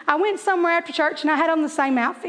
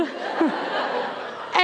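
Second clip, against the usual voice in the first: silent wheezing laugh; breathless laugh; 5 dB quieter than usual. Real, not acted. Telling the audience about her fall, wheezing laugh. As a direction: laugh with no voice at the memory.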